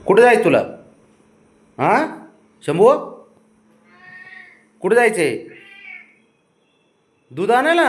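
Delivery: high, loud, eager asking; excited coaxing questions. Real, not acted. Excitedly asking pet where to go.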